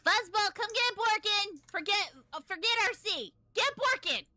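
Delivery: high panicked shouting; frantic shout. Acted. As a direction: yell for your companion, panicked. Yelling for help across field.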